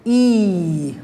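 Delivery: E held as a long ee; long e sound. Shown as a demonstration. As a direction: stretch the E out long. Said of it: "So we'd say".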